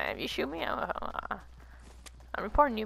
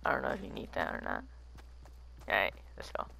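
whispering; 7 dB quieter, faster than usual. Real, not acted. Quiet aside during game.

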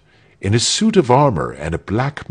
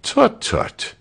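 high-pitched little tut of disapproval; mild tutting executioner. A narrator's reading. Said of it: "murmured the executioner".

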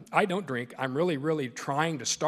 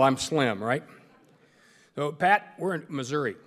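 normal talking voice

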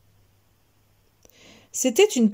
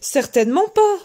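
higher-pitched indignant denial; protesting; 8 semitones higher than usual. A narrator's reading. Tied-up wolf answering the mother goat, protesting. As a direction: protest your innocence, pitched up. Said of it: "protesta Loup".

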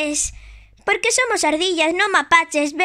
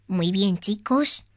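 mother toy voice; 8 semitones lower than usual. Pretend. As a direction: play the gentle mother of Tag. Plays Tag's mother.

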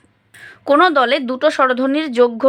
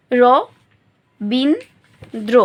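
"র বিন দ্র" split into three beats with pauses; syllable by syllable; slower than usual. Shown as a demonstration. Teacher showing students the syllables, "র বিন দ্র" syllable by syllable. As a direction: say "র", "বিন", "দ্র" one at a time. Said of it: "কতটুকু অংশ একবার উচ্চারণ করতে পারছি".

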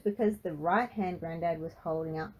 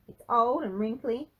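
louder, higher retort; defensive; 6 semitones higher, 6 dB louder than usual. A narrator's reading. Frankie insults granddad's face, defensively. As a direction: hit back defensively, voice up. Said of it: "Frankie said defensively".

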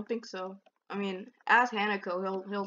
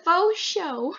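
imitating Sonia; 6 semitones higher than usual. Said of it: "'Cause she said it so calmly".

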